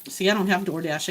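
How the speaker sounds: ordinary delivery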